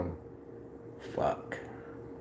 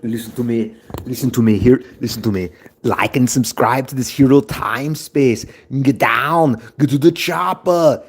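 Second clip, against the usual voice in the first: loud, barking movie action hero; building from insistent to shouted commands; action hero impression; 2 semitones higher, 5 dB louder than usual. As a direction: bark it like an action star giving orders. Acted action-hero impression, catchphrases.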